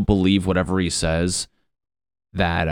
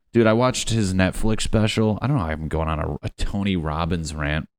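ordinary talk

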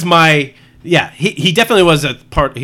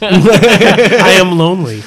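slow, higher, louder robot voice; mock Siri voice; 4 semitones higher, 6 dB louder, much slower than usual. Siri answering her boyfriend, slow mock-robot voice. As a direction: drag it out like a voice assistant. Plays Siri's lonely answer.

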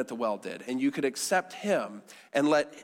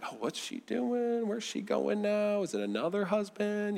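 mock nosy neighbor, pitched up; nosy gossiping neighbors; 6 semitones higher, 4 dB quieter than usual. Acting out nosy neighbors gossiping at windows.

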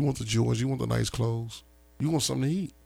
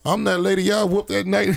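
higher and laughing through the line; amused high lady's voice; laughing retelling; 6 semitones higher, 4 dB louder than usual. Retold or imitated. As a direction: tell it half-laughing as the lady. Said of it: "She was like".

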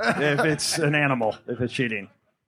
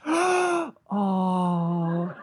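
imitated audience gasp, then cooing "oh"; gasp, then coo like a charmed crowd; adoring gasp; 2 semitones higher than usual. Comic mimicking women seeing the koala bears, adoring gasp. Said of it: "every woman in the audience went".

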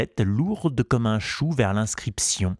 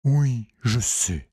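slow, gentle pony voice; lightly voiced wise old pony; talking pony; 3 semitones higher, slower than usual. A narrator's reading. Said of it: "répondit le poney".

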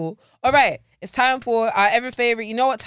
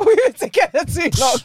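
laughing; 7 semitones higher, 6 dB louder than usual. Real, not acted. Laughing repeat, louder.

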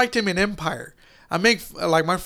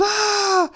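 high mock cry of pain; quick high imitated yelp; imitated short cry. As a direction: mimic the actor's brief yell. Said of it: "he goes".

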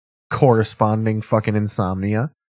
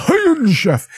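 booming high announcer call of the show name; dramatic show announcer; 4 dB louder than usual. Retold or imitated. Host mimicking the old show's intro, booming announcer.

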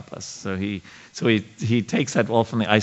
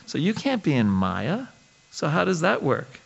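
put-on higher, rising, wide-eyed girlish questions; sweet innocent voice; 6 semitones higher, 3 dB quieter than usual. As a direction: imitate a sweet, innocent young devotee asking her guru. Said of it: "I'm trying to imitate her".

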